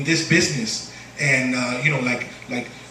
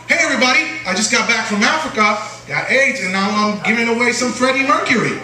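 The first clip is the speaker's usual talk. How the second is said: mock upbeat comic; 7 semitones higher, 3 dB louder than usual. Mock comic's stage greeting quoted.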